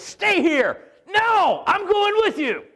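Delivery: high, loud shouted argument; cavemen shouting. Retold or imitated. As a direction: bark it like stubborn cavemen. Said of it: "this is how they conversed".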